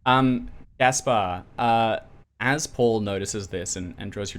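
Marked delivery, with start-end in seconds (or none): none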